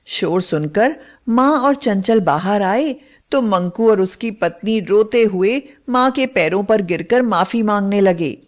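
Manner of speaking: usual speaking voice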